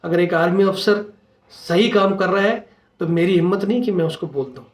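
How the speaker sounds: ordinary talk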